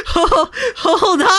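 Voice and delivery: ordinary talk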